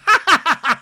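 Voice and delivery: high loud fake cackle; a hammed-up wine-glass laugh; mocking cackle. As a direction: cackle like a smug reality star. Mock cackle of Brittany.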